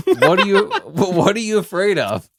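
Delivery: high flirty tease; high teasing twerker voice. Retold or imitated. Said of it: "they say to you".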